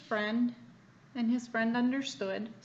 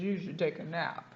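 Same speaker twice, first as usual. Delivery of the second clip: sleepy alligator; 6 semitones lower than usual. A narrator's reading. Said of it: "the alligator down here says".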